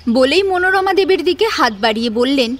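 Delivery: usual speaking voice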